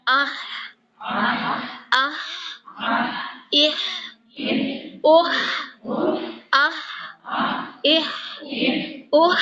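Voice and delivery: آہ اہ اوہ each breathed from the throat; ha drills. Shown as a demonstration. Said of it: "نکل رہا ہے". (آہ اہ اوہ ہوا کے ساتھ)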